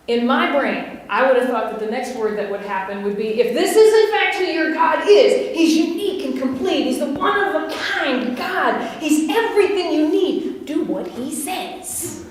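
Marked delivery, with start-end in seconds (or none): 3.4-12.2 preacher hamming up a bossy Moses for the congregation; mock Moses. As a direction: play a blunt Moses as a joke, slowing on the last command. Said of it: "Aren't you grateful I'm not Moses?".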